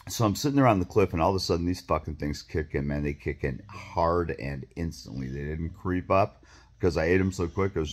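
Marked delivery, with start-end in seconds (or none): none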